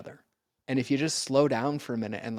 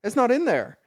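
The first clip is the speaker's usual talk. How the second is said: joking quip; 7 semitones higher, 5 dB louder than usual. Retold or imitated. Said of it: "I joke with people".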